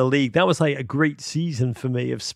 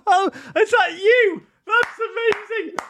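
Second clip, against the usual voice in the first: much higher, laughing delight at the reveal; laughing surprise. Real, not acted. Host delighted as caller mentions his tattoo, laughing high. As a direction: burst out laughing, thrilled it's him.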